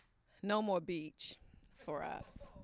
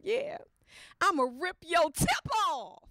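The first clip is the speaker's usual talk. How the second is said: high, louder cheeky threat; laughing bold comeback; 8 semitones higher, 4 dB louder than usual. Retold or imitated. Wife turning the tables on husband, cheeky and laughing.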